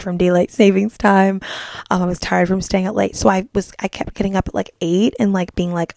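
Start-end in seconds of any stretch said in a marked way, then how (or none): none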